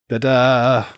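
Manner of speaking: loud, gloating fanfare of a ta-da; triumphant ta-da. Real, not acted. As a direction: announce your win like a magic trick. Triumphant flourish after winning argument.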